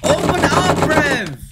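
loud impatient call; shouting. Real, not acted. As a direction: yell it through the door. Yelling at a locked door.

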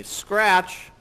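quoting the MC. Retold or imitated. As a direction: needle a worker with a mild complaint. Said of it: "he said to me".

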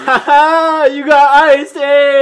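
loud teasing yell; gleeful shout. Real, not acted. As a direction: shout it in triumph. Second ice prank.

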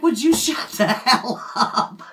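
loud, drawn-out exasperated holler, half laughing; mock scream with a laugh in it; retold husband's scream. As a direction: imitate your husband yelling, stretching the words. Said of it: "He screams at me".

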